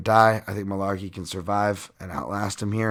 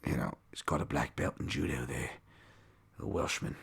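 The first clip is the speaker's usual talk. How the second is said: put-on a failed stab at a Welsh accent, lower and quieter; bad Welsh accent; 8 semitones lower, 10 dB quieter than usual. Host mocking a fighter in a failed Welsh accent. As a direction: botch a Welsh lilt. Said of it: "that's not even a Welsh".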